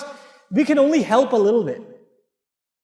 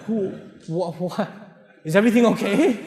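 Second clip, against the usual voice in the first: stammering, low mock bewilderment; shocked husband; 3 semitones lower, 3 dB quieter than usual. Husband baffled by his smiling wife, mock shocked. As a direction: play a baffled husband, lost for words. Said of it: "he'll get all shocked".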